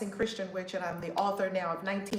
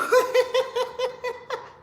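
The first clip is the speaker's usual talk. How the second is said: high squealing giggle; delighted laugh; 4 dB louder than usual. Real, not acted. Delighted by baby's wave.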